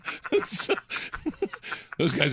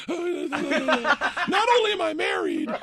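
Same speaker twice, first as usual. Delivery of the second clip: slow, laughing through it; laughing mock line; much slower than usual. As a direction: spit it out between laughs.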